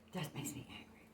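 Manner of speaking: whispering the anger under the breath; whispered. Shown as a demonstration. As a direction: drop to a hushed whisper. Repeats it, second time whispered.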